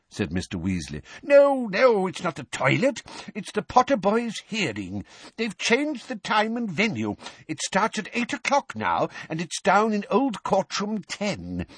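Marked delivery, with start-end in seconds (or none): 1.2-11.7 put-on high, flustered old wizard; timid old wizard. A narrator's reading. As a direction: rush it out in nervous worry. Perkins brings urgent news.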